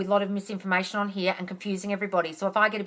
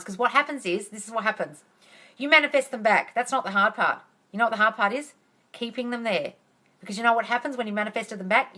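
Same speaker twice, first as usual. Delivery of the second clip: ordinary talk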